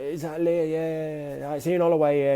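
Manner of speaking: a hint of the cheery boss ringing up; Ian Holloway. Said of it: "I can't do a Bristolian accent".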